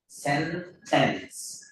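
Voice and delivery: सेंटेंस said very slowly, each sound clear; सेंटेंस stretched slow and clear; slow model pronunciation. Shown as a demonstration. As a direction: drag out सेंटेंस syllable by syllable. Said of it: "इसका तलफ़ुज़, इसका pronunciation आप देख लीजिए".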